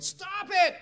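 high-pitched imitated yell; retold yell. Joe to Andrew, reminded of past miracles, yelling. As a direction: cut him off with a high yelled outburst. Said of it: "Joe finally just yelled".

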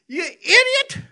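high, loud yelled insult; mock angry insult. Father scolding his prodigal son, mock yelled insult. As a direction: hurl it like a furious father.

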